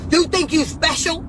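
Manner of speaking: high mocking officer snap; mimicking the officer. Guard sneering at the detained celebrity, mock high. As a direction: throw it at her like a sneering guard. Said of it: "she was like".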